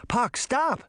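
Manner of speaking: high-pitched urgent cry, moderate volume. A narrator's reading. Sabrina trying to halt Puck, crying out. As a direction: call out to halt him. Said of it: "Sabrina cried out".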